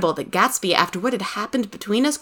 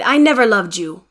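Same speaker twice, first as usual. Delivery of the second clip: louder, forceful denial; heated rejection; angry cry; 2 semitones higher, 5 dB louder than usual. A narrator's reading. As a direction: deny it hard, voice raised. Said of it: "Tom cried".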